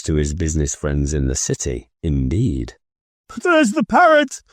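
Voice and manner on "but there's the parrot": much higher delighted shout; excited cry. A narrator's reading. Scrooge spotting the parrot, high delighted cry. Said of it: "cried Scrooge".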